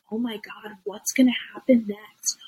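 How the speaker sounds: mock suspense, starting half-whispered; hushed suspense. Host mimics viewers watching a food video, hushed suspense. Acting out a rapt viewer's thoughts.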